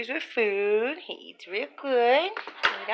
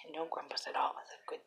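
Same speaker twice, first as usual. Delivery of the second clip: soft whisper beside eating kitten; 5 semitones lower, 8 dB quieter than usual. Real, not acted. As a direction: whisper so not to disturb him. Whispering as cat eats.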